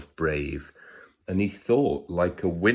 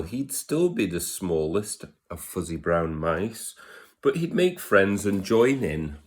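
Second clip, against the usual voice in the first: ordinary delivery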